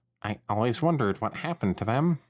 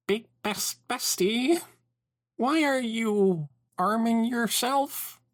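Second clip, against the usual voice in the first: nervous high voice; 13 semitones higher, much slower than usual. Acted. Nervous question about weapons.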